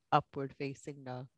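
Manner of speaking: ordinary delivery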